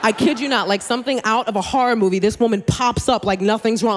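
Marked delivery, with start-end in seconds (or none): none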